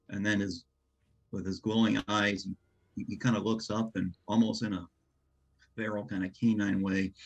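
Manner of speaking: usual speaking voice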